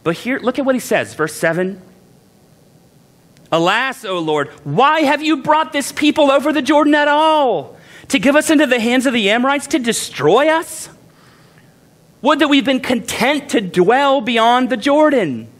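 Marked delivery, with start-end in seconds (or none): 3.5-15.5 high pleading complaint to God; dramatic lament. Retold or imitated. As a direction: wail it like a despairing leader. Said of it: "This sounds just like the Israelites".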